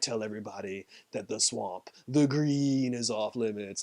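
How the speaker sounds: lightly voiced creature warning; mostly low and soft, an ominous message; Swamp Thing. Creature sends the survivor off with a soft warning.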